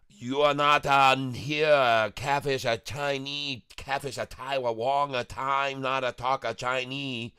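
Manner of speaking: lightly put-on broken-English Chinese accent, clipped grammar; a silly character bragging about speaking Chinese; mock Chinese accent.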